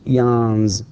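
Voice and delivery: "yawns" slow, ending on a buzzing z; "yawns", slow, with a voiced z ending. Shown as a demonstration. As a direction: stretch out "yawns" and buzz the z. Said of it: "notice how I pronounce it".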